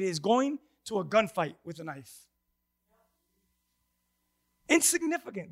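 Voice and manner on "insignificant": Insignificant pitched high, then falling; stress on Insignificant. Real, not acted. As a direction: lean on the word Insignificant. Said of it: "I'm stressing the word insignificant".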